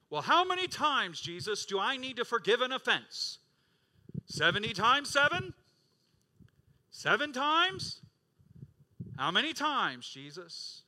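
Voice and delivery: loud, high, driving sermon climax; full-force preaching. Acted. Pastor thundering at his congregation, loud and high. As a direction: preach it out to the whole church.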